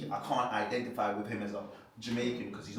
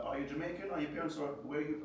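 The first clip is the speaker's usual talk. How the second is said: higher-pitched mimicked surprise; imitated curious questioning; 6 semitones higher than usual. Quoting a curious woman's questions.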